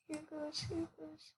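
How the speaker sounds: barely audible, tense murmur; nervous whisper. Real, not acted. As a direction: hold your breath and whisper it. Bracing before a trade.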